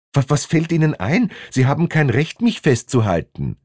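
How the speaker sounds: stammered high-pitched outrage; acted indignation, falling off at the end; indignant protest. Man protests at being held.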